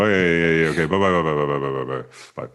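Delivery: mumbled run of byes, sinking and fading; rapid-fire phone sign-off. Shown as a demonstration. Irish host showing how calls end, rapid fading byes. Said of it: "it has to be said fast".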